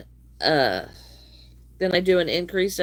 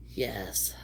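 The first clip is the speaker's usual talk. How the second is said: whisper; 10 semitones lower, 11 dB quieter than usual. Real, not acted. Quiet aside.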